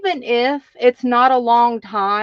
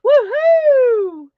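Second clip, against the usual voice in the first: high, loud whoop; excited woohoo; cheer; 12 semitones higher, 7 dB louder than usual. Real, not acted. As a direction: celebrate the first two viewers with a whoop. Host cheering first commenters.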